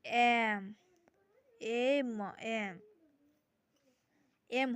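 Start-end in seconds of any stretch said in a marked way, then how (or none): 0.1-2.8 एम repeated, with the opening ए vowel clear; sounding out M. Shown as a demonstration. Teacher sounding out the letter एम. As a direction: repeat एम to show it starts with a vowel. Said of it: "इसका उच्चारण क्या हो रहा है".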